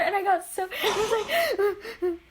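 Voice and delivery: high voice breaking into laughter; laughing. Real, not acted. Friend retelling an embarrassing fifth grade water incident, laughing. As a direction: try to talk through helpless laughter.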